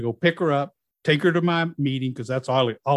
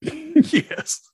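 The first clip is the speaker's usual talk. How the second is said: high laugh into a yes; laugh, then confirm it; real laugh of delight before answering; 14 semitones higher than usual. Real, not acted. Confirming surprising detail, laughs.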